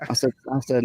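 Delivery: ordinary talk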